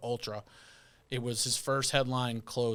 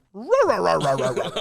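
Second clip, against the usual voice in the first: loud, high garbled dog babble; mock someone with cartoon-dog gibberish, dropping in pitch; Scooby-Doo babble; 4 semitones higher, 8 dB louder than usual. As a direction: jump in high and loud, then come down. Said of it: "He Scooby-Dooed me".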